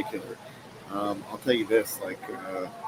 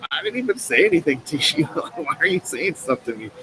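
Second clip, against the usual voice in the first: raised, louder mock protest with a laugh; retold indignant protest; 5 semitones higher, 6 dB louder than usual. Restaurant owner recalls answering abusive customers, indignant, half-laughing protest.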